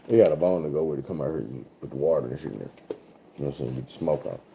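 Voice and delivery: dropped low and soft, fast aside; quiet aside. Real, not acted. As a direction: mutter the explanation under your breath. Quiet aside explaining the bong.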